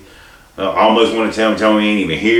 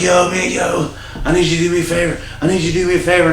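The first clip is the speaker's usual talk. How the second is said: pleading coworker voice; 10 semitones higher, faster than usual. Retold or imitated. Coworker begging in retold story.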